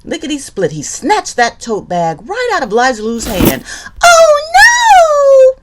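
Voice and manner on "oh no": very high, loud mock wail; crying out. Liza Lou crying out as the haunt grabs her bag, loud high wail. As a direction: shriek it in pretend despair. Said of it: "cried Liza Lou".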